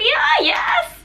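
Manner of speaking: high, loud, eager shout of yes; excited yes. Retold or imitated. Telling Jongin her cousin loves him, a high excited shout. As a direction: blurt it out thrilled.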